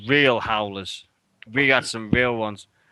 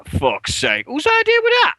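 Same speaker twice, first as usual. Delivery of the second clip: builds to a very high, loud cry; exasperated outburst; 5 dB louder than usual. Retold or imitated. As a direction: act out the fury at the idea.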